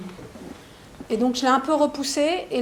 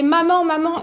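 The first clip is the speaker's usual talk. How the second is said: higher, louder call for mum; retold calling out; 5 semitones higher, 6 dB louder than usual. A boy calling his mother, loud retold call. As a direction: cry out for your mother. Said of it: "Et il criait".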